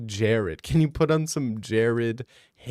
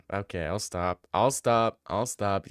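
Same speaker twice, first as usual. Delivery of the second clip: ordinary delivery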